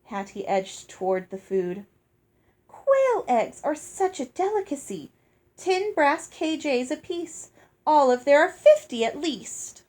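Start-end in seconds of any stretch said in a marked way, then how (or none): 2.7-9.8 lightly voiced prim sister, higher; a hint of a greedy snob. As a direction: gush over the eggs, a touch haughty.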